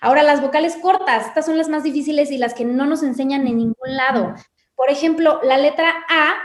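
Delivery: ordinary talk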